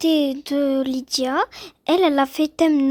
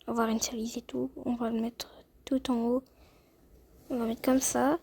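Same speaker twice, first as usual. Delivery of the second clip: quick whispered muttering to herself; much softer, half whispered; 8 dB quieter than usual. Real, not acted. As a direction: whisper the steps under her breath. Muttering while editing outfit.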